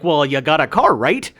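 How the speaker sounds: a loud mock Canadian ending on a rising right; mimicking right tag. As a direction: copy the Vancouver guy's tag. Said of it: "And then I was like".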